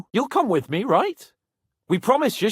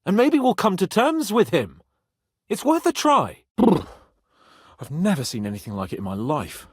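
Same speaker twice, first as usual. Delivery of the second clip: ordinary talk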